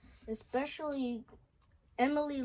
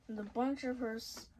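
ordinary delivery, nothing marked

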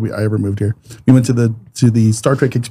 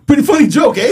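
put-on Canadian accent; 11 semitones higher than usual. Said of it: "Canadian accent".

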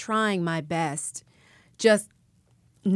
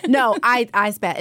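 normal talking voice

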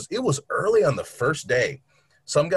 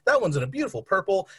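higher, chirpy salesman patter, rising to a high peak at the end; mock cheery sales pitch; 6 semitones higher, faster than usual. Salesman to the man who cut his finger, mock cheery and high. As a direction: sell the knife with fake brightness, deadpan to his bleeding. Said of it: "I'm like".

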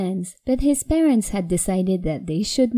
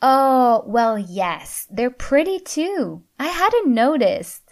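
innocent; 5 semitones higher, 4 dB louder than usual. A narrator's reading. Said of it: "She smiled innocently".

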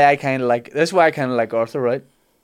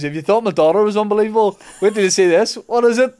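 high, louder salesman-like hype; hammed-up excitement; 8 semitones higher, 5 dB louder, faster than usual. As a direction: build up the big reveal, pitching higher. Character hypes up his table gift.

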